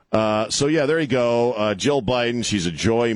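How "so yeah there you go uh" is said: ordinary delivery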